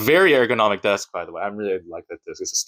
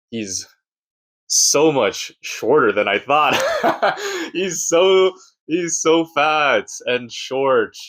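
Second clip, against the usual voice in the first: imitating fans laughing at his height; higher, drawn out, cracking up mid-mockery; mocking laughter; 5 semitones higher, much slower than usual. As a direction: laugh through the mock disbelief.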